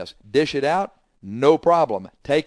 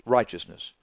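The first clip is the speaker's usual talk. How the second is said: usual speaking voice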